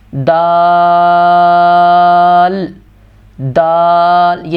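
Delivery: دال held long, tongue on the palate; drawn-out recited دال; long daal. Shown as a demonstration. Teacher reciting دال slowly for the class. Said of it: "دال کی آواز نکالنی ہے".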